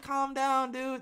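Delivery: mock calming. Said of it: "I'm like".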